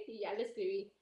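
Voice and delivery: normal talking voice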